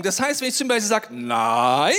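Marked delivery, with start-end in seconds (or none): 1.1-1.9 low drawn-out NEIN swooping up at the end; demonstrated intonation. Shown as a demonstration. Owner telling the dog no, "Nein" low with rising end. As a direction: say "Nein" low, then slide it sharply upward. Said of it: "er versteht nur Betonung".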